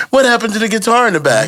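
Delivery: high, fast curious viewer. Retold or imitated. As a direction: ask like a puzzled viewer. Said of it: "people were wondering".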